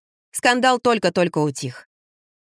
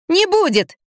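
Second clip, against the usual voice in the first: put-on very high, emphatic girl's retort; defiant; 11 semitones higher, 3 dB louder, slower than usual. A narrator's reading. Girl contradicting the angry mother, defiant. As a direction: squeal back a stubborn denial. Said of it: "топнула ногой Лиза".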